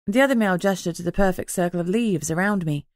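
usual speaking voice

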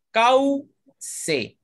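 "cauce" as cau-ce, high first beat; slow, split "cauce" with au kept together; syllable by syllable. Shown as a demonstration. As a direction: hold "cau" as one high syllable, then ce. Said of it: "Ese es un diptongo".

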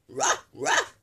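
high breathy yappy woof; dog barks. Acted. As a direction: yip like a tiny toy dog. Voicing the toy dog.

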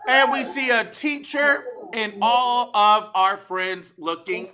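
ordinary delivery

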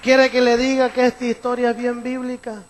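loud rousing preacher call; preaching at full force. Acted. Preacher calling the congregation to applaud, loud and rousing. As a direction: stir the church to applaud, loud.

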